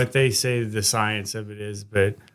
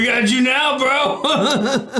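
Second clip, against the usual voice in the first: mocking gotcha; 12 semitones higher, much slower than usual. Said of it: "It's like".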